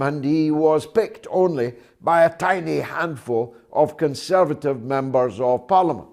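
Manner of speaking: ordinary talk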